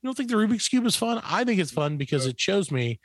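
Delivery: ordinary talk, nothing marked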